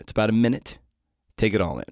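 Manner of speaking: ordinary delivery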